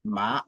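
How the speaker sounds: "ma" gliding upward; drawn-out rising "ma". Shown as a demonstration. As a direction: start "ma" low and let it climb. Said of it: "ça monte".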